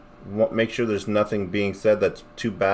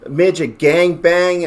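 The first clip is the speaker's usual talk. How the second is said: slow, louder reading of the words; reading out shocked; 8 semitones higher, 5 dB louder, slower than usual. Retold or imitated. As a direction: spell out each shocking word slowly. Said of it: "lighting up the phone going".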